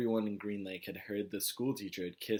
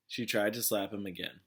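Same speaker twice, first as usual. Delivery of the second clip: plain reading voice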